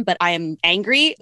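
ordinary delivery

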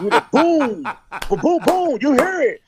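high, loud booms, worked up; excited re-enactment. Retold or imitated. Driver relives thunder sounding like bombs, worked up. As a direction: relive the blasts at high pitch. Reliving storm that felt like bombing.

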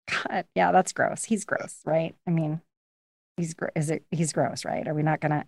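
quiet, unsure whisper; hushed whisper. Retold or imitated. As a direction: whisper it, half tempted. Girls quietly tempted, whispering.

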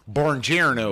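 slow, loud Buongiorno in a mock Tennessee accent; Tennessee-accented Italian. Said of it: "His, his Tennessee accent".

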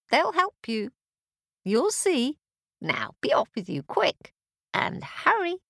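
catty voice with high swoops; helpful little cat. Acted. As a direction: advise like a cat, rising to an urgent finish. Cat gives escape advice.

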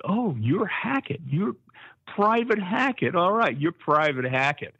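high, drawn-out oh you're Hackett; drill instructor catching on. Retold or imitated. Drill instructor realising the recruit's name, slow and high. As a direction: savour the name slowly, amused. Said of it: "He said".

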